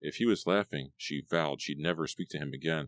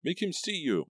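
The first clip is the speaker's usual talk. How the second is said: pleading; 13 semitones higher than usual. A narrator's reading. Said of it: "Melissa pleaded".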